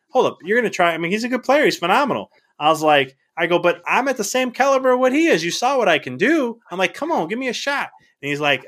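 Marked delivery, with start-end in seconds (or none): 0.1-2.3 tag. Retold or imitated. Argues with scout about rival. 3.6-6.6 high-pitched, urgent self-defense; retold plea to be judged fairly; insistent pleading. Argues his case to scout. 6.9-7.9 retold wheedling plea; pleading. Begs scout for a chance.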